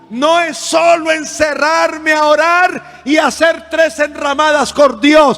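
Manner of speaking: loud, drawn-out emphatic sermon; full-force preaching. Real, not acted. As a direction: preach it slowly, punching each phrase.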